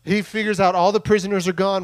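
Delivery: normal talking voice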